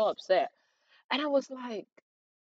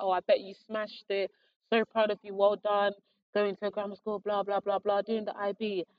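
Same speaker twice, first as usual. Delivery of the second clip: head of year; 4 semitones higher than usual. Retold or imitated. Head of year gushing praise.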